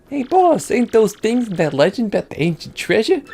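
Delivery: gangster henchman. Acted.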